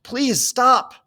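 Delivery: high pleading cry; desperate gasp. A narrator's reading. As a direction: beg through the pain. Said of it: "Cole gasped".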